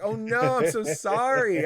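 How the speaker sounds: high, loud, drawn-out sorry; mock dismayed apology. Player apologising to Nico for embarrassing her, mock dismay. Retold apology to the upset kid.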